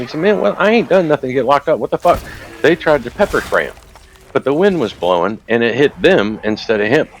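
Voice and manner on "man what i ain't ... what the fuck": high, exasperated mock outcry; retold indignant protest. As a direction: plead as the man being arrested. Retold man protests arrest.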